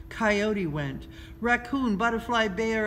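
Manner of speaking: plain reading voice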